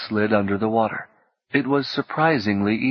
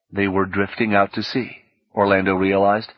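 ordinary delivery, nothing marked

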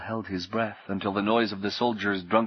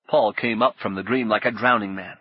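plain reading voice

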